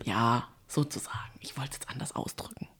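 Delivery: low, soft embarrassed whisper. Real, not acted. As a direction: whisper it sheepishly. Embarrassed admission.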